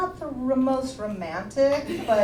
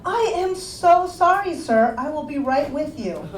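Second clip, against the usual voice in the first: higher, sugary apologetic waitress voice; overly polite waitress; 6 semitones higher than usual. Retold or imitated. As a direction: fawn over the customer like a flustered waitress. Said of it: "hostess hat".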